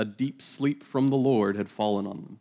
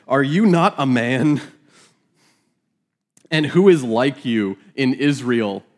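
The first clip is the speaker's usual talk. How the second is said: biting mock challenge, louder; sarcastic; 4 dB louder than usual. David mocking Abner for failing to guard the king, sarcastic. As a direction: taunt him, sneer at the question. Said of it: "this is biblical sarcasm".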